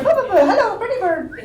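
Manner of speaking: high squeaky parrot chatter. Retold or imitated. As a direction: talk like a parrot saying hello.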